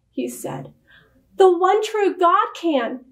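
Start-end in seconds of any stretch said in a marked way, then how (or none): 1.4-3.0 high, fervent retort; crying out. A narrator's reading. Wife defying her husband's threat, crying out. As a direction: cry it out with conviction, pitched up. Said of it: "cried Missus Orestiel".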